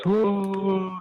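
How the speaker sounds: high, drawn-out crowd whoop; crowd cheering. Acted. Crowd at a fight cheering as fighters are shown, high drawn-out whoop. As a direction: imitate a crowd's long "whoa". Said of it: "the crowd goes".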